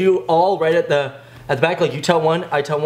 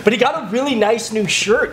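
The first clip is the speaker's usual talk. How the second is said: ordinary delivery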